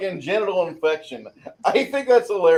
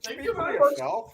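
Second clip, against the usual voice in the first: put-on Indian accent; 5 semitones higher, 6 dB quieter than usual. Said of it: "we're a bunch of racists".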